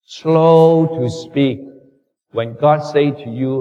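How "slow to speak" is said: drawn-out slow. Shown as a demonstration. Preacher repeats the verse slowly.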